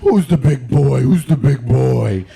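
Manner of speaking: loud, sing-song doggy talk; gushing over a woman's dog in a lift, loud and cooing; baby talk to a dog. Retold or imitated. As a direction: coo loudly at the dog like it's a baby. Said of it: "I was like".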